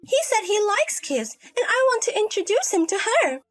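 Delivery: high-pitched, self-absorbed daughter; hopeful adult daughter. Acted. Daughter wants him to meet Alice, hopeful. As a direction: argue for the romance in a high voice.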